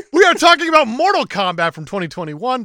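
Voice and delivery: usual speaking voice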